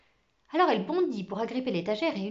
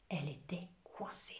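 very soft, low whispered narration; suspenseful whisper; 6 semitones lower, 15 dB quieter than usual. A narrator's reading. Suspense as mouse is cornered. (murmure chuchoté, plein de suspense)

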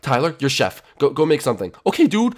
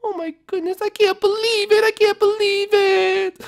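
distraught wail; 3 dB louder than usual. Acted. Princess betrayed by her partner, high wail. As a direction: wail in disbelief, stretching the repeat.

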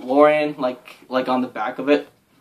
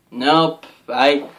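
ordinary delivery

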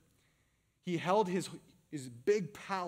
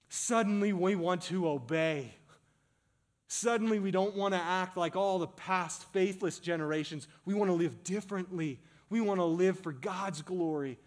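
loud, raised preacher's voice, building with each phrase; impassioned preaching; 4 semitones higher, 5 dB louder than usual. Real, not acted. Preacher exhorting the church to live differently, impassioned preaching. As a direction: drive each line home with full force.

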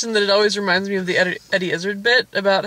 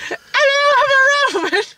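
high squealed mock-Roman accent, drawn out; 4 dB louder, slower than usual. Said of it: "I'm out of accents".

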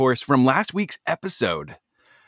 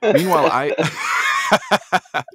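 laughing; 8 semitones higher, 4 dB louder, much slower than usual. Real, not acted. Laughing while recalling his laugh.

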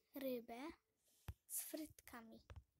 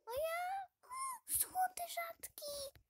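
high, gasping delight at a rare find; excited gasp; 4 dB louder, slower than usual. Real, not acted. Finding a golden rare ball in the machine, squealing excitedly. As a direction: squeal it out, breathless with joy.

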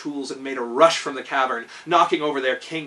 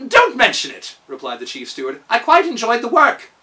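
high, loud eager steward; bright and pleased with himself; Kaliko; 7 semitones higher, 8 dB louder than usual. A narrator's reading. Steward brushing off thanks, high and eager. Kaliko answers Tick-Tock.

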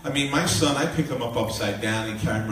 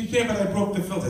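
imitated young daughter; 9 semitones higher, faster than usual. Daughter answers apologizing father.

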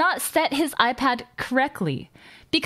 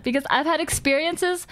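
ordinary talk

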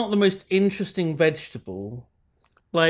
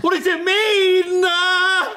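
high, slow fake crying; mock wail; 11 semitones higher, 4 dB louder, much slower than usual. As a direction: whimper it in mock terror. Mock panic at the omen.

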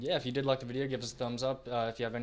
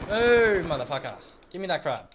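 frustrated outburst; 6 semitones higher, 11 dB louder than usual. Real, not acted. Teammate steals his crab kill.

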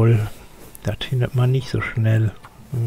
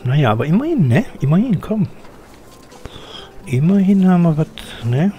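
mock triumph; 6 semitones higher, faster than usual. Real, not acted. Gamer cheering over an almost empty crate, mock triumph. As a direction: celebrate a tiny win.

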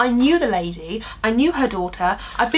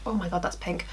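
normal talking voice

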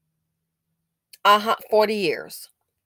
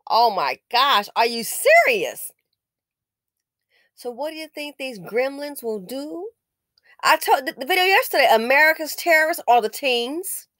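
usual speaking voice

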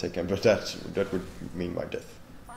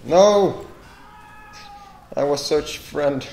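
loud, high wounded cry; anguished yell; 7 semitones higher, 9 dB louder, slower than usual. Real, not acted. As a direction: cry out in betrayal. Killed while disguised.

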